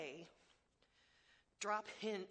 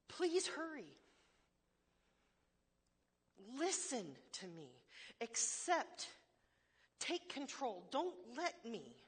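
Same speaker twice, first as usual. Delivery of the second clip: a raised, pressing plea to God, words short and direct; urgent plea; 3 semitones higher, slower than usual. Acted. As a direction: beg God straight out, no hedging. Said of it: "It is direct and desperate".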